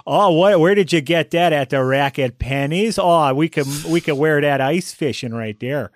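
loud, bright Upper Peninsula accent. Shown as a demonstration. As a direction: gush about the suit in broad Upper Midwest. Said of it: "the Upper Peninsula voice".

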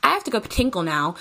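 high, flustered cheery exit; high mock-breezy excuse to flee; hasty excuse. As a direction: bluff and escape politely. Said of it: "And so I was like".

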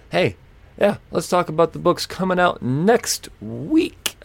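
high, slow sing-song announcement. Real, not acted. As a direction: lift it up and stretch it out. Restarts topic in a sing-song.

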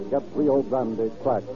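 ordinary talk, nothing marked

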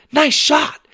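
high, loud congratulation; excited high shout; shouted cheer. A narrator's reading. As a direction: cheer on a teammate. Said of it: "shouted Lydia".

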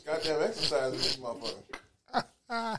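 talking while chewing hard; words round a tough chewy bite; mouth full. Real, not acted. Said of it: "You look like your jaws hurt".

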